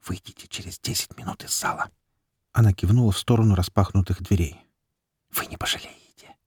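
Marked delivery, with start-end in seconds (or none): 0.1-1.9 hushed secret whisper. A narrator's reading. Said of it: "и прошептала". 5.3-6.4 hushed promising whisper. A narrator's reading. Still whispering to him.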